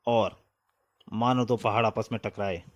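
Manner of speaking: usual speaking voice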